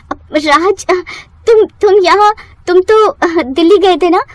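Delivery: high, panicked stammering; frightened stammer. Acted. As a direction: caught out and scared. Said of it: "रिया डर जाती है". (डरी हकलाती आवाज़)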